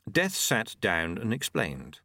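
usual speaking voice

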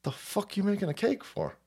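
soft, puzzled grandpa grumble; lightly voiced suspicious grandpa; mock grandpa. Grandpa asking why the boy bakes, soft puzzled grumble. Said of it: "my grandpa goes".

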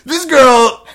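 high, very loud hammed-up tribute; mock drunk speech. Host pretends giving that speech about some girl, mock drunk shouting. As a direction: proclaim it like a drunk toastmaker, laugh creeping in. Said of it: "I did that speech about some girl there".

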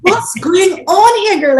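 high, loud, thrilled mock cheer; excited mom. Mother cheering her daughter enrolling in college, excited. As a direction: gush at your daughter like a proud mom. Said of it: "I'm like".

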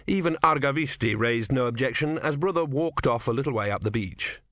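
plain reading voice

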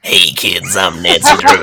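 put-on low old-lady voice, laughing; old Nancy Drew. Shown as a demonstration. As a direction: croak it like an eighty-year-old lady. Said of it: "Which of these sounds more like Nancy Drew".

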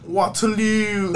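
loud, high, stretched mimicry; imitated yell. Retelling friend yelling at subway strangers, imitated holler. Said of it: "this man's just yelling".

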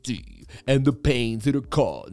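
deadly serious crypto prophet, drawn out; bearable bull impression. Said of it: "Who wants a bearable bull impression?".

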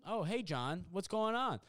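imitated cheery greeting. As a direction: mimic a friend happily spotting someone. Oblivious friend greets teller.

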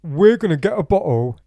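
put-on high, loud, pushy drunk voice; mock drunk lad. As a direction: play the drunk friend bragging on the phone. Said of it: "I didn't say it like that".

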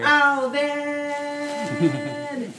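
high squeaky helium voice. Real, not acted. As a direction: chipmunk voice from helium. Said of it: "chipmunks over here".

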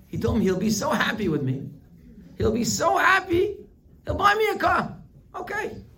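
put-on high, loud, thrilled kid voice; giddy kid. Son telling friends about the car, high and giddy. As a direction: squeal it like a kid promised a car.

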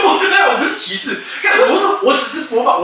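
high, loud, helpless laughter through the words; laughing so hard he can barely get it out. Real, not acted. Defending his imitation to friend while laughing.

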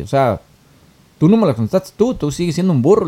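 loud, high acted-out protest; retold indignant accusation. Lawyer insists the millionaire is still a donkey, retold indignantly.

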